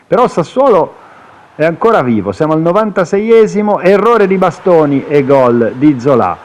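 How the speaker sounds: excited rising play-by-play; breathless italian goal commentary. Acted. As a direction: call the late goal with urgency.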